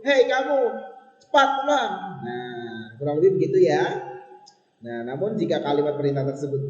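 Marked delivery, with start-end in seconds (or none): 0.0-1.9 high, loud exclaimed command; exclamation-mark reading. Shown as a demonstration. Teacher shows the exclamation mark difference, loud command. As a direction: call out the order sharply high and louder. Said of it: "Beda dengan yang tanda seru".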